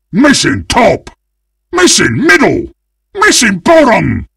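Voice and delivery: high, urgent team call-outs; punchy high shouts; calling out warnings. Acted. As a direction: holler each warning across the map. Calls warnings to team mates.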